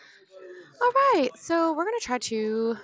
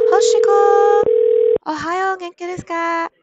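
high loud happy call-out; excited shout; 9 semitones higher, 13 dB louder than usual. Real, not acted. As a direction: call her name out excitedly. Calling out greeting to viewer.

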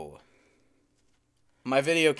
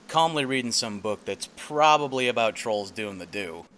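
nature documentary voiceover; 2 semitones lower, 4 dB quieter than usual. Acted. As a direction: narrate like a nature show host, hushed. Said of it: "a voiceover for a nature documentary".

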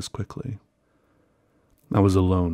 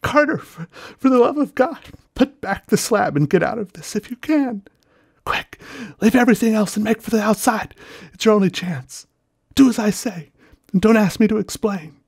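much higher, louder frantic plea; wild consternation; 13 semitones higher, 4 dB louder than usual. Acted. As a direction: beg in rising panic. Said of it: "in a pitch of wilder consternation".

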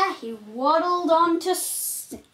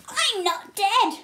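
Yoshi voice; 6 semitones higher than usual. Acted. Yoshi pops back up.

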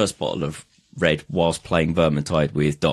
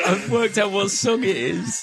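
laughing; slower than usual. Real, not acted. Over drunk video, laughing.

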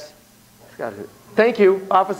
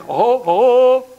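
booming slow ho ho ho; knight voice; 5 semitones higher, 5 dB louder, much slower than usual. Acted. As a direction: play a jolly knight. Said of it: "I immediately think".

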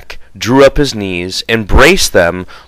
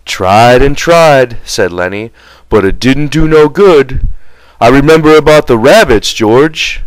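put-on louder, childlike eagerness; eager Lenny; 4 semitones higher, 5 dB louder than usual. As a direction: proudly recall the rabbits.

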